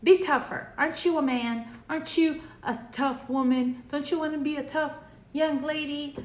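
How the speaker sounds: a hint of a scolding mom; stern parent scolding. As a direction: play the tough-love parent telling the kid off. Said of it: "mom would say or dad would say".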